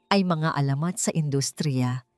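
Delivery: plain reading voice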